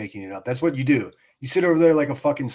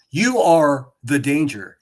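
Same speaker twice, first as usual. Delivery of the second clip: slow, louder, every word hammered home; accusing emphasis; 6 dB louder, slower than usual. Real, not acted. Accusing Queen Bee directly.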